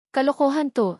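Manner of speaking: sharp, high shout of disbelief; a furious heiress shouts it down. A narrator's reading. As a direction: throw it out loud and high. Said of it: "sigaw ni Isabel".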